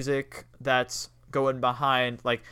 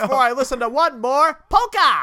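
high, loud hammed-up despair; melodramatic; 10 semitones higher, 7 dB louder than usual. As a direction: wail it like a tragic diva. Melodramatic complaint about polka.

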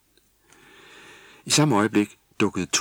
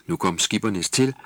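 ordinary delivery

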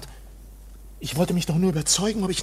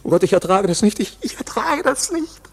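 breaking down; 3 semitones higher, 4 dB louder than usual. Acted. Overwhelmed visitor in the waiting room, anguished. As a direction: fall apart, the voice climbing.

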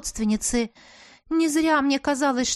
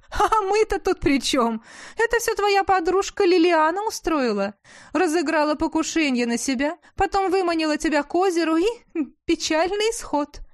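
taunting duchess; 7 semitones higher than usual. A narrator's reading.

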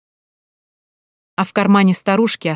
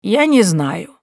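indifferent; 3 semitones higher, 4 dB louder, much slower than usual. Acted. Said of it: "не выходя из состояния полного безразличия".

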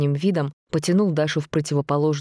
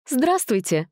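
put-on perky girl voice; 10 semitones higher, slower than usual. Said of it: "бодро сообщила Даша".

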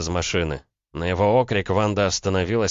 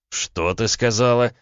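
slow, pitched-up angry question; menacing question; 6 semitones higher, 4 dB louder, slower than usual. A narrator's reading. Man stung by comparison to his father, menacing. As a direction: slowly challenge her to repeat it.

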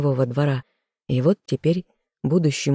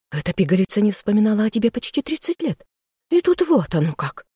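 higher, fast furious ranting woman; angry sister; 6 semitones higher than usual. A narrator's reading. Sister berating the king, furious and high. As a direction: rant at the fool brother.

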